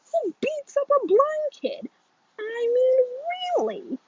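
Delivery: high, loud wail of injustice; aggrieved boy pleading his case; outraged protest. A narrator's reading. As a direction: build to a high-pitched, indignant whine. Alex protests his suspension to principal.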